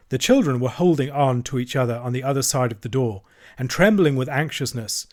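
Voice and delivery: ordinary delivery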